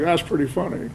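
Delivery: lightly voiced film star; a hint of an actor's dry amusement; Jimmy Stewart. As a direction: deliver the punchline as the amused star. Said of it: "Jimmy Stewart was like".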